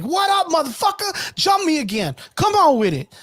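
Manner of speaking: high, slow taunting yell at the attackers; taunting shout. Retold or imitated. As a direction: call out a challenge like a tough boss. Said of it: "he comes out all fucking like".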